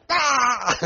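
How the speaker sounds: high, sudden scream of joy; thrilled high-pitched shriek; excited scream. Retold or imitated. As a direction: shriek with delight at the news. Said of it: "She's screaming around the basement".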